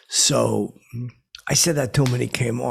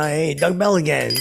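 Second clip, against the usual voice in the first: higher, louder cheerful hello; eager reintroduction; 6 semitones higher, 5 dB louder than usual. Retold or imitated. Bell reintroduces himself to O'Cannon, bright and loud. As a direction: push the name brightly. Introduces himself yet again.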